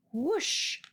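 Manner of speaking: normal talking voice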